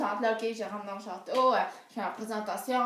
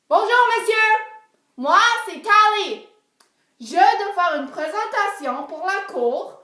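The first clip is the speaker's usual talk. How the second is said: loud, higher, slowed shout at the guards; 7 semitones higher, 9 dB louder than usual. Retold or imitated. Girl yelling her introduction at ignoring guards, loud shout. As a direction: yell the whole pitch so the deaf guards hear. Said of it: "après leur avoir crié après".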